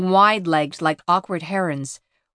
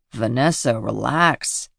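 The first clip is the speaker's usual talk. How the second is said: low, gentle father voice; soothing father; 8 semitones lower, slower than usual. Acted. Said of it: "he says as she trembles".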